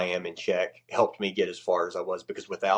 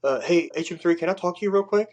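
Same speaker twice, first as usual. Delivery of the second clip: timid airman; 9 semitones higher, 4 dB louder than usual. Retold or imitated. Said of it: "and he goes".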